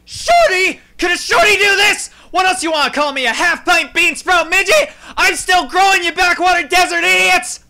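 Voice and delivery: indignant shrieking tantrum; furious shout. Acted. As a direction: explode at the insult, full volume. Furious outburst at being called short.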